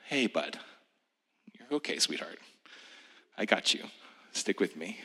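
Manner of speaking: put-on soft, tender reassurance to a child; gentle and sweet. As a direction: hush it softly like comforting a little one.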